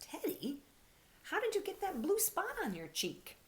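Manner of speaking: put-on a small boy asking his bear; high curious child voice; child's voice. Paul, a child, asks.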